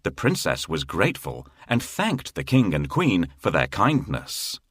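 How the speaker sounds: usual speaking voice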